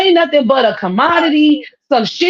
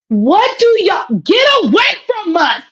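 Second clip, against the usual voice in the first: indignant rant; 7 semitones higher than usual. Real, not acted. Ranting at K-pop groups.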